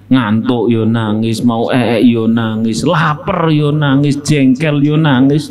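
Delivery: slow, even chant of each cause; slow rhythmic list. Real, not acted. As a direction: count them off slowly. (diucapkan lambat berirama)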